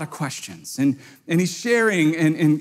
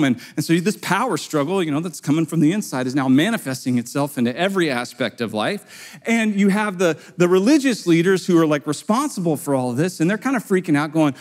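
ordinary talk, nothing marked

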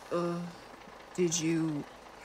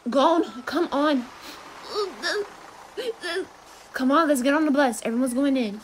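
louder, higher call across the crowd; 8 semitones higher, 3 dB louder than usual. Acted. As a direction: hurry him along, calling out. Calling friend to the bus.

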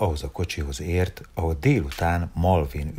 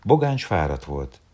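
ordinary delivery, nothing marked

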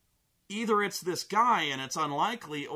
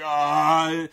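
loud drawn-out mock scream; imitated scream; 10 dB louder than usual. Imitating the kid as it scurries, imitated scream. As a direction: wail like the crab-walking kid.

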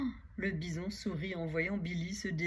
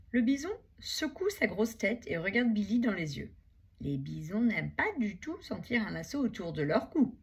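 plain reading voice

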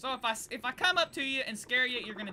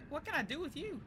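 ordinary talk

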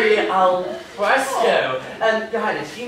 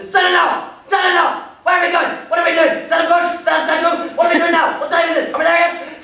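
retold shouting; 8 semitones higher than usual. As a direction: bellow it like a nagging old man at the window.

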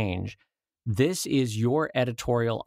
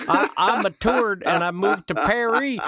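put-on cowboy accent; 8 semitones higher, 5 dB louder than usual. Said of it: "like a cowboy".

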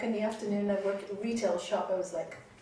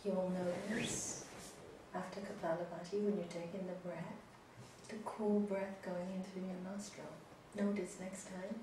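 soft spa whisper; 3 semitones lower, 14 dB quieter than usual. Acted. Leads breathing softly.

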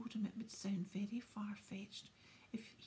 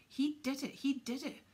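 loud, higher, spitting out the accusation; spat; 5 semitones higher, 7 dB louder than usual. Acted. Filch accusing Harry over his petrified cat, loud spitting fury. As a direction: jab the words out furiously. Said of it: "Filch spat".

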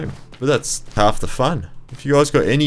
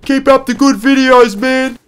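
high, very loud retold shout; yelling from a roof; 10 dB louder, slower than usual. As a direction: holler it like a fan calling down from a rooftop.

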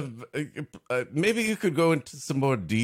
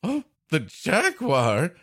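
high, louder mock rich lady whine; 5 semitones higher, 4 dB louder than usual. Co-host mocking the rich lady over her lost car. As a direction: play the spoiled rich woman, put out. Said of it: "she's a rich lady".

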